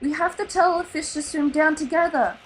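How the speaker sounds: don't back down; insisting. A narrator's reading. Nemo convincing his father of the plan, firm insistence. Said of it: "Nemo insisted".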